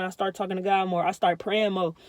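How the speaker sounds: normal talking voice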